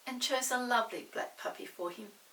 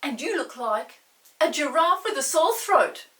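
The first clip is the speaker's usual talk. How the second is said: put-on loud, higher rude boy's comeback; rude retort; 6 semitones higher, 15 dB louder than usual. Peter answers Molly's teasing, loud and rude. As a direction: snap back at your sister. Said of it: "said Peter rudely".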